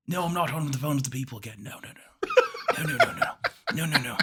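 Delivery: put-on soft, hurried British old man off the phone; hushed British denial to his daughter; Britishy accent. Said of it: "Britishy accent".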